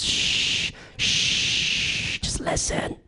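soft unvoiced shushing whisper. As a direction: hush them like a meditation guide. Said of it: "guided meditation of sorts".

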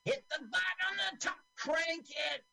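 put-on very high, strained kid voice; bossy kid character. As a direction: squeal orders like an exasperated child. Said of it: "So he's telling him how to do it".

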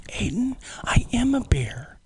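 whispered confession. Retold or imitated. Teacher confiding to his student, whispering. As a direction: lean in and whisper it.

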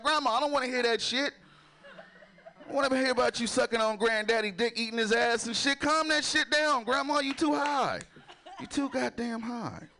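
high, protesting grandson act; comic exasperated pleading. Retold or imitated. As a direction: scold grandma in mock disgust, pitch up. Scolds grandma in retold scene.

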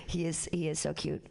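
normal talking voice

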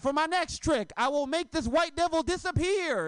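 put-on a kid's grand stage-magician voice. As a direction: announce the trick like a child magician. Kid's magician boast on playground.

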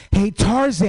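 high, loud holler of a nickname; calling out. Acted. Oakland guy hailing the long-haired comic, high loud call. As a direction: sing out to catch someone's attention. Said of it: "this guy was like".

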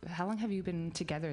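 ordinary talk, nothing marked

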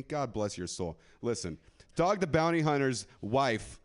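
ordinary talk, nothing marked

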